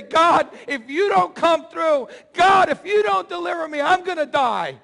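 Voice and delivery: loud, high pleading shout; desperate cry to God. Pretend. A believer crying out to God at night, loud pleading shout. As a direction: cry out as if your life depends on it.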